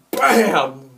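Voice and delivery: loud, explosive blow sound; imitated impact. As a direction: burst out like a pole striking hard.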